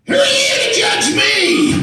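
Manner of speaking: put-on high loud slow defiant teen girl. Daughter defying the judge in court, high loud defiance. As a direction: fling it at the judge like a sulky teenager. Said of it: "she literally said this".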